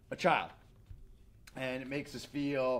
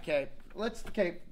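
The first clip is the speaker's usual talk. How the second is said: usual speaking voice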